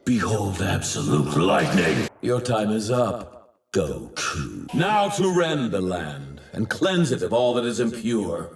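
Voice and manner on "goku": low, soft naming of a foe; menacing address. Acted. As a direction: say Goku low and quietly, as a threat. Villain addresses his foe.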